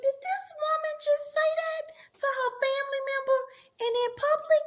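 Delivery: stunned, high-pitched disbelief; shocked. Retold or imitated. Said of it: "And I said".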